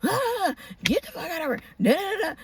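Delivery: high-pitched, softened mock yell. Woman imitating the man yelling as he walks into the house, mock yell. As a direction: imitate someone going off, without real volume. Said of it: "was, like, yelling".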